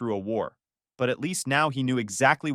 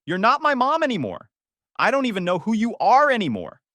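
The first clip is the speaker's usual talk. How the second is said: much higher, louder, cracking with rage; furious shout; 10 semitones higher, 5 dB louder than usual. Retold or imitated. Son disowning his mother, shouting in rage. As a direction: disown her at the top of your voice.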